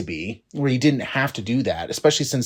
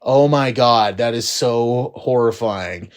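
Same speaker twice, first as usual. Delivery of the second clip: normal talking voice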